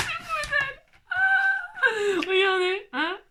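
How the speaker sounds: very high laughing squeal. Pretend. Crafter squeals with delight at her bee. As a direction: shriek it with laughing glee.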